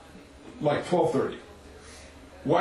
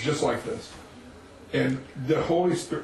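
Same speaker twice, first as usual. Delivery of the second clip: usual speaking voice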